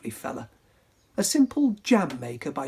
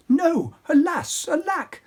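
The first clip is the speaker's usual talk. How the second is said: prince crying out; 12 semitones higher, 4 dB louder than usual. Acted. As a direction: wail it dramatically. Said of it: "The prince cried".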